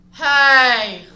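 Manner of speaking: sudden loud backwoods holler; loud, drawn-out yell out of the dark; scary holler. Acted. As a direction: bellow a startling hey from the woods. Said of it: "the scariest sound I think I've ever heard".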